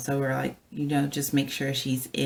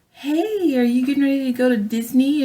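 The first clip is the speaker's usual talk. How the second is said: put-on much higher, fast and bright-toned talk to a toddler; sweet voice to a child; 10 semitones higher, 7 dB louder than usual. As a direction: coax a little girl cheerfully. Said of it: "And I was like".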